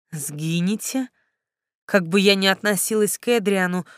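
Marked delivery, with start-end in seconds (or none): 0.1-1.1 quiet, disbelieving rising Сгинете; stunned echo. Acted. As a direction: echo the word in shock. Heroine echoes the threat. (тихо, ошеломлённо переспрашивая)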